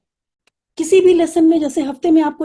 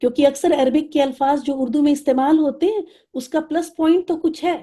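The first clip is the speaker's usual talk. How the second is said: normal talking voice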